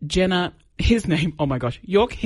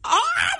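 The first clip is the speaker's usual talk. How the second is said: very high delighted squeal; mock squeal. Host retelling her reaction to the proposal, high squeal. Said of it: "And I'm like".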